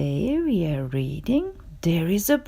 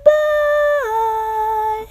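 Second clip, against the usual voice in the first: cheery high goodbye; 11 semitones higher than usual. Acted. Birds fly away.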